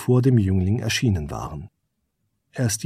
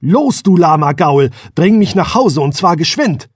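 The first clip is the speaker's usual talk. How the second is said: shouted, higher and louder; loud command; 6 semitones higher, 6 dB louder than usual. A narrator's reading. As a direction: bark the order out loud. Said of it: "befahl der Prinz lauthals".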